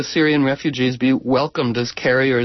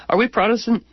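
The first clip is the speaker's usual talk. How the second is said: curious son; 6 semitones higher than usual. Retold or imitated. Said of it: "and his son says".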